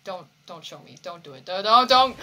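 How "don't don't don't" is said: loud pleading yell; desperate shout. Real, not acted. Pleading with the character not to speak, shouting. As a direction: beg at full voice.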